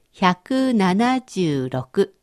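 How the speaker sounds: each number said very slowly; slow dictation. Shown as a demonstration. Teacher reading a number slowly for the learner to translate. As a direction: read it out slowly so they can catch it. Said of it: "私の言う日本語を中国語にしてください".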